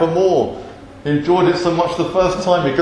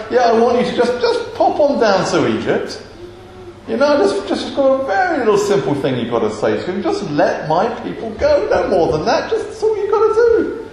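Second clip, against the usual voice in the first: higher, playful, offhand instructions; breezy understatement; 5 semitones higher than usual. Acted. God sending Moses down to Egypt, breezily downplaying it. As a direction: make a daunting errand sound like nothing.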